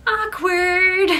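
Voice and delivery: high, loud sing-song blurt; loud blurted awkward. Retold or imitated. Friend blurting at the awkward table, loud and high. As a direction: blurt it out to break the tension. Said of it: "all of a sudden out loud goes".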